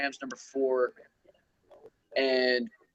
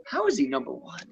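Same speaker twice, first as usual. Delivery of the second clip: much higher, baffled question; high disbelieving question; incredulous. Real, not acted. Outraged at kicker ranking.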